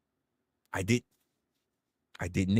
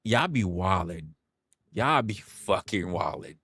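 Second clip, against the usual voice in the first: normal talking voice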